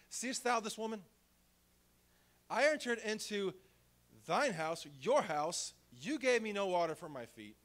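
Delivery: loud, high, ringing scripture reading; preaching at full force. Acted. Preacher reads Jesus rebuking Simon, ringing and loud. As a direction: proclaim the rebuke as if to the whole church.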